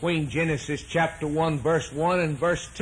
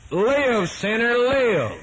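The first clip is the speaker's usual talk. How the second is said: higher, slow, ringing call; preacher's cry; 8 semitones higher, 4 dB louder, slower than usual. Acted. Preacher calling out to the sinner, a ringing, drawn-out cry.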